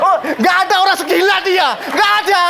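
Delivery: high-pitched amused disbelief; laughing exclamation. Real, not acted. Preacher marvelling at his crazy convert, laughing exclamation. Laughing amazement at the man's craziness.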